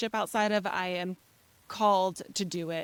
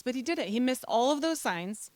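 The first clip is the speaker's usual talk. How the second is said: usual speaking voice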